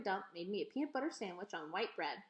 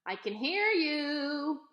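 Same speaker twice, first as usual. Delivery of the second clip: slow, loud holler; a drawn-out shouted reply; hollering back; 6 semitones higher, 6 dB louder, much slower than usual. A narrator's reading. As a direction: call out across the street. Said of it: "I hollered".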